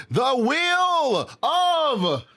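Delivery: milk the pun like a lament; mock dramatic. Streamer renaming the wheel of fortune, mock anguish. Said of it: "Might as well call it the Wheel of Torture".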